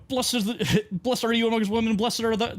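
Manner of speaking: imitating an Irish priest gabbling a prayer, high and fast; rushed Irish priest. Mocking the funeral priest's rushed Irish prayer. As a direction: rattle the Hail Mary off like a hurried Irish priest. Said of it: "'cause he's going, like, a million miles per, per hour".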